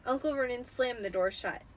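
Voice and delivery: plain reading voice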